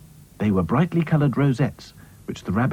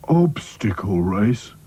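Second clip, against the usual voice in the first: slow, glum, worried doll voice; gloomy Sad Sack; slower than usual. Acted. Sad Sack dreading the obstacle race, slow and glum. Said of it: "thought Sad Sack".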